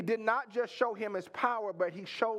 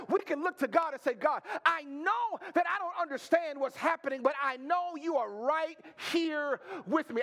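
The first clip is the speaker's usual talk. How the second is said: high-pitched, driving sermon climax; impassioned preaching; 5 semitones higher than usual. Real, not acted. Preacher encourages the despairing congregation, impassioned and insistent. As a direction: lift the voice and keep pressing it home.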